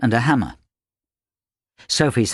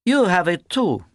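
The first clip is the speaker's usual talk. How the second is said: high-pitched accusation; accusing; 9 semitones higher than usual. A narrator's reading. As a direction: point the finger, pitch raised. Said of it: "she accused him".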